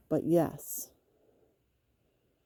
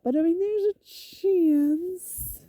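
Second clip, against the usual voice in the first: 1 high, slow teasing; 12 semitones higher, 4 dB louder, slower than usual. Real, not acted.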